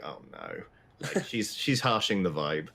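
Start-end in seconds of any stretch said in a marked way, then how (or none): 0.0-0.6 low, hushed groan; dismayed oh no. Acted. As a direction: lean back, deflated. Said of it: "Dale kind of leans back and is like".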